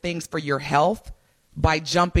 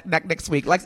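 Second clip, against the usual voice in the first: normal talking voice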